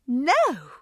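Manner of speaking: loud, high, horrified refusal. A narrator's reading. Friend refuses to come inside, horrified. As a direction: recoil at the very idea. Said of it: "Marianne looked horrified at the thought".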